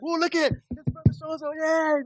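high, bright mock cheer for a newcomer; excited greeting. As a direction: squeal it like a thrilled churchgoer. Imitating excited congregation greeting newcomer.

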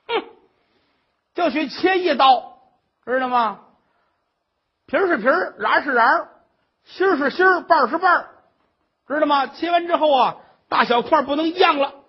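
loud, high nagging tirade; shrill scolding old mother. Acted. Mother-in-law scolds the crying daughter-in-law, loud and high.

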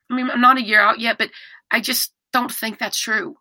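normal talking voice

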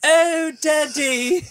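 very high, slow mock-British little girl; daughter doing British. Host imitating daughter asked to do accents, high British voice. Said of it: "I asked her to do British".

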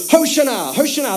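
high-pitched call to chant; demonstrated shout. Shown as a demonstration. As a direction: lead the crowd's cry, pitch lifted. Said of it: "can we just start shouting".

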